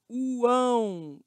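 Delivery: "Uão" drawn out as one long glide; isolated vowel glide. Shown as a demonstration. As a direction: stretch the three vowels of "Uão" together. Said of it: "Olha as três vogais".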